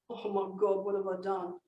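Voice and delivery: low, soft, guilty self-talk; acted hushed dismay at oneself; remorseful husband. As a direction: sink into quiet regret. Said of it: "he begins to feel like a real heel".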